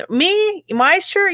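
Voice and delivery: high, questioning mock surprise; acted surprise. Passer-by answering an unexpected compliment, high mock surprise.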